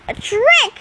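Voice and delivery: high, indignant shout; shouted accusation; outraged shout. A narrator's reading. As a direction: cry foul, pitch way up. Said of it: "she shouted".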